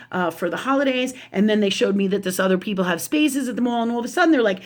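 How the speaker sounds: normal talking voice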